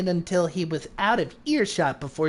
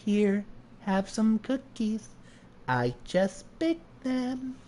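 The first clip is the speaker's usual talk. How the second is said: meek hostess; 2 semitones higher, 7 dB quieter than usual. Acted. Waitress offers freshly baked cookies, soft meek voice. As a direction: offer it softly and slowly.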